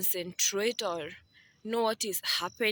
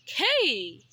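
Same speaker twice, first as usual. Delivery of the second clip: sudden shout; 7 semitones higher, 8 dB louder than usual. Real, not acted. Sudden outburst mid-talk.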